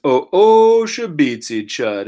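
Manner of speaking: Lushootseed words, slowly and carefully; Lushootseed phrase. Shown as a demonstration. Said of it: "This says".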